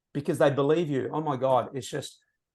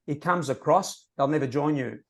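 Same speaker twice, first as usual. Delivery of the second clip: normal talking voice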